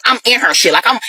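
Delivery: ordinary delivery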